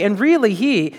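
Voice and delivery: ordinary talk, nothing marked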